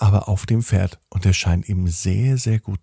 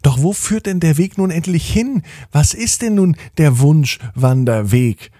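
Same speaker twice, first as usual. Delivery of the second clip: higher-pitched, mildly impatient questioning; a bit impatient; 7 semitones higher, 4 dB louder than usual. A narrator's reading. Alina questions where the trail finally leads, a bit impatient. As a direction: press for an answer with slight impatience. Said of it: "sie schien ein bisschen ungeduldig zu sein".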